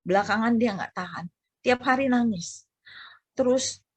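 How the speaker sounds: ordinary talk, nothing marked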